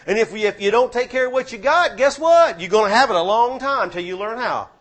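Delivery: full-force preaching. Acted. Preacher urging the church to take care of what they have, full force. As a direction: build it up and hammer the congregation.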